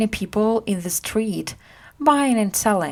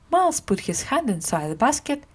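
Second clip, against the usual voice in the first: ordinary delivery, nothing marked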